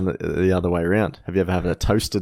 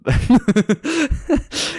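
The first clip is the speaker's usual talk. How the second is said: laughing; 4 dB louder than usual. Real, not acted. Breaks up laughing mid-joke.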